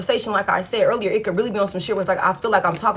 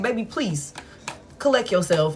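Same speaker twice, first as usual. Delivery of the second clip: soft mocking plea; 3 semitones lower, 5 dB quieter than usual. Mock pleading to ex.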